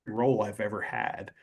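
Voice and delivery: normal talking voice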